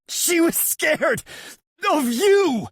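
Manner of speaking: very high, slow yelled plea. A narrator's reading. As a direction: yell it to get through. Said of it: "he yells".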